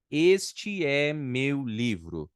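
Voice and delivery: very slow, each word laid out; slow model sentence. Shown as a demonstration. Teacher reading an example slowly for students. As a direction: spell it out slowly for the class. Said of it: "vou dar um exemplo aqui".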